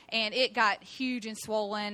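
ordinary talk

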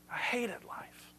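slow, hushed whisper; barely voiced, drawn-out despair. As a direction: whisper it slowly, like a bleak confession. Repeats Solomon's despair in whisper.

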